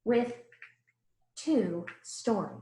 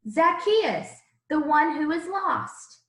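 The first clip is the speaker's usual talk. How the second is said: calling out; 6 semitones higher, 4 dB louder, slower than usual. Acted. Jesus announces to the crowd.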